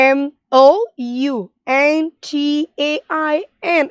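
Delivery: each letter apart, teaching pace; slow spelling. Shown as a demonstration. Teacher spelling mountain slowly for children, slow and clear. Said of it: "how can we spell the word mountain".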